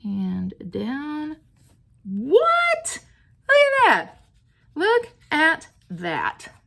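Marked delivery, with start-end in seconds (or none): 2.0-4.2 very high, loud squeal of amazement; amazed squeal. Real, not acted. Crafter showing viewers her stamped result, amazed squeal. 4.8-6.5 high, slow, word-by-word wonder; emphatic amazement. Real, not acted. Crafter showing viewers the result, slow emphatic delight.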